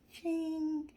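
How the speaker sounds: high playful "ching"; cheeky ding sound; sparkle sound effect. Pretend. Playful sparkle after makeup brag.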